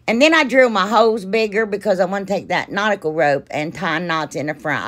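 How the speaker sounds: ordinary talk, nothing marked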